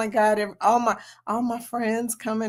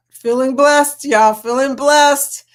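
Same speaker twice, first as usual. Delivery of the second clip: louder, slow feeling blessed; loud gushing; 3 semitones higher, 8 dB louder, slower than usual. Real, not acted. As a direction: beam it out. Thankful to members.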